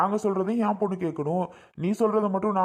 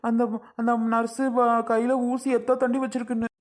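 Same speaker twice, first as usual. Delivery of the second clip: higher, tearful comic panic; crying in dread; 4 semitones higher than usual. Acted. Father spotting the nurse's needle, tearful panic. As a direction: wail in fright at the needle. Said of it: "இந்த அண்ணே அழுகிறாரு".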